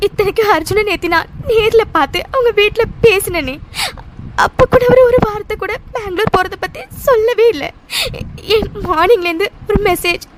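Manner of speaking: choked-up talking through tears; distraught woman crying over her partner; tearful. Acted. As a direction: speak as if barely holding back sobs.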